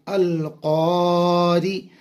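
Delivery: अल क़ारी chanted slowly, each syllable drawn; slow spelled recitation. Shown as a demonstration. Teacher builds the word for students, slow chant. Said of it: "रा के नीचे ज़ेर बारीक".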